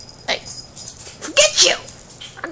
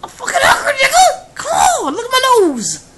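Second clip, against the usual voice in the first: whiny hungry plea; 5 dB louder than usual. Acted. Begging for pizza, shouting.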